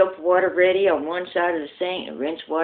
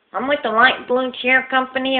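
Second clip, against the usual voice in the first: lisping salesman; 9 semitones higher, 4 dB louder than usual. Acted. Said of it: "he talks with a lisp".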